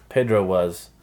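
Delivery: ordinary delivery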